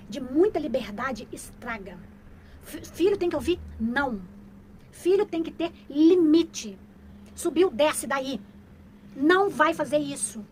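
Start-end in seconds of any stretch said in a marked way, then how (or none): 2.6-10.4 put-on loud, slow, firm orders to a child; stern mother commands. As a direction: lay down the law like a strict mom.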